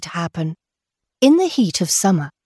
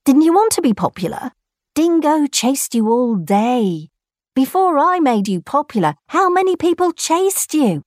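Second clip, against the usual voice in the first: higher, quick mocking voice; teasing character voice; 6 semitones higher than usual. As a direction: needle the kangaroo. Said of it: "said Nakwang".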